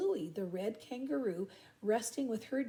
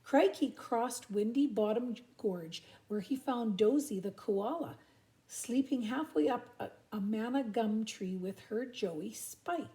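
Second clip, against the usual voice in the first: usual speaking voice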